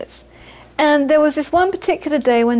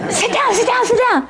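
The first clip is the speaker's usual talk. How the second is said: high, hurried sit down, laughing; urgent hush; 11 semitones higher than usual. Retold or imitated. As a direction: urge him to sit, half laughing. Telling Geshe to sit, laughing.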